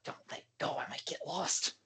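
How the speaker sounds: soft, unvoiced plea; timid whisper. Shy girl grabs Eric's hand, timid whisper. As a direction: whisper it like a scared little sister. Timid sister pleads with Eric.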